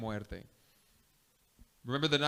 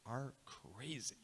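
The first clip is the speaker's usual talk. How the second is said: mocking whisper; 2 semitones lower, 15 dB quieter, slower than usual. Council whispering a taunt at Jesus, voiceless sneer. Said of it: "And they're saying".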